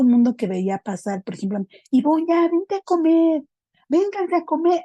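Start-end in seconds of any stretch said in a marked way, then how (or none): 1.9-4.8 high, louder sing-out to passers-by; llamando a comer. Retold or imitated. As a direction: imitate mom calling everyone in to eat, pitched way up. Mother calling everyone to eat. (llamado de mamá, agudo y fuerte)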